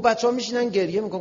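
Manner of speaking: imitating an agitated objection; retold protest. Retold argument over showing film.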